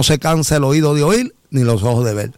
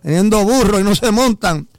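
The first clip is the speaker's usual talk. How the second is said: high-pitched heckle from a passing car; imitating a passerby yelling; retold shout; 5 semitones higher than usual. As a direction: call out mockingly, pitched up. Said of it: "le gritó, le dijo".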